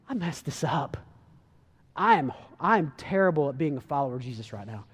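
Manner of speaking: low, subdued self-reproach; mildly acted, downcast inner voice; retold remorse. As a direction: confess failure to yourself. Peter's remorse voiced in retelling.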